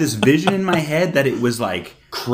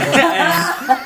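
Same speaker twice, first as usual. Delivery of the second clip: high burst of laughter; laughing; 4 dB louder than usual. Real, not acted. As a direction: break into loud giggles.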